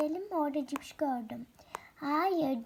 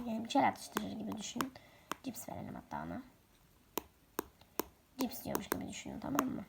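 whisper; 4 semitones lower, 7 dB quieter, faster than usual. Real, not acted. Whispered stage directions.

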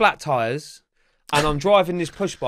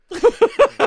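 loud laugh; 6 dB louder than usual. Real, not acted. Laughing at his own story.

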